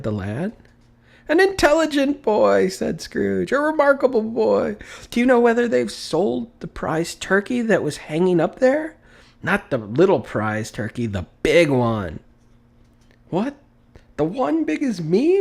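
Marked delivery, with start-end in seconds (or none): 1.3-12.2 delighted praise. A narrator's reading. Scrooge praising the boy. 13.3-15.4 put-on amazed boy. A narrator's reading.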